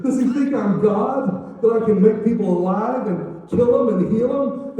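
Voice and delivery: loud, high and aghast; distraught king. Acted. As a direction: cry out as a king at his wits' end. King tears clothes in distress.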